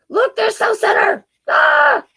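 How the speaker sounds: high, loud thrilled shriek; excited scream. Pretend. Reader shows how she screams arriving at the mall, thrilled shriek. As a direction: squeal like a kid at the mall. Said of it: "That's how I scream when I get to go to the mall".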